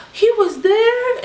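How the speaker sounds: high, slow, stunned "He was there?"; shocked. Retold or imitated. Retelling a friend's shock at the name, high and stunned. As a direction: gasp it out in disbelief.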